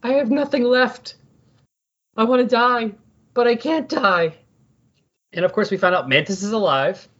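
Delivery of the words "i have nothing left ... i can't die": high-pitched anguished wail, hammed-up; mock despair. Co-host voices a villain who has nothing to kill, in mock despair. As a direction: despair aloud in a raised, straining voice. Voicing the despairing Obliterator, existential crisis.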